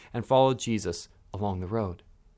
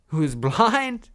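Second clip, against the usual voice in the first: ordinary talk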